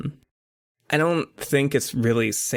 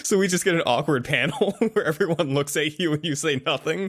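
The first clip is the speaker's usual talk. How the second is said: laughing while talking; 6 semitones higher, faster than usual. Real, not acted.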